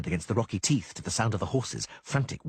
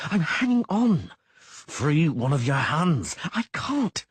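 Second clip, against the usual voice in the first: strained desperate exchange; 7 semitones higher, 5 dB louder, slower than usual. A narrator's reading. Dangling characters arguing in strain.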